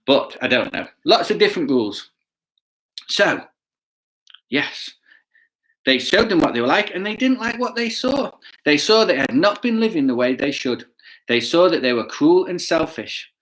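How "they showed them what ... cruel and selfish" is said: put-on lively storybook narration to kids; reading to children. A narrator's reading. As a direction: tell it like a picture book for children.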